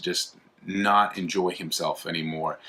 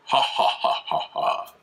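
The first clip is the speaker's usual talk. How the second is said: breathy, measured villain cackle; hammed-up sinister "ha ha ha"; mock evil laugh; slower than usual. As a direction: imitate a gloating villain's laugh, each beat separate. Imitates the guide's gloating laugh at twist.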